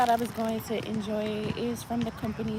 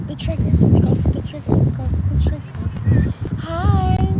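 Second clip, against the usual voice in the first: loud hammed-up asmr whisper; mock asmr; 7 semitones higher, 10 dB louder than usual. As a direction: overdo the ASMR voice. Parody asmr triggers.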